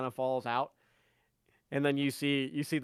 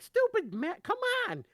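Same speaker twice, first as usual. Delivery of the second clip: put-on much higher, exasperated child's whine; a little kid losing patience with his matches; frustrated kid; 4 dB louder than usual. GM voicing the kid fumbling matches, high frustrated whine. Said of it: "he's like".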